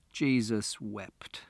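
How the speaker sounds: defeated father, quietly; weary despair. A narrator's reading. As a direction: mutter it slowly, head in hands. Father's head in his hands.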